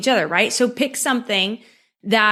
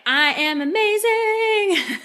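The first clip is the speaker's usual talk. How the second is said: high, slow, sung-out cheer; excited self-praise; 11 semitones higher, much slower than usual. Shown as a demonstration. Coach showing viewers how to hype themselves, sung high and slow. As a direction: belt it like a proud little song. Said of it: "get excited, you know, be like".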